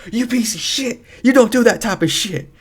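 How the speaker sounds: imitated high-pitched furious scolding; high, indignant mock outrage; angry sister. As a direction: mimic an angry sister telling someone off, voice shooting up. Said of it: "She got angry".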